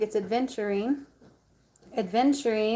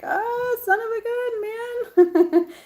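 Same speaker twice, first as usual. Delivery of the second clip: high, slow, drawn-out cry of pain; mock exclaim; 13 semitones higher, 5 dB louder, much slower than usual. Retelling her arm swelling, hammed-up cry. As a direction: ham up the ouch. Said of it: "I'm like".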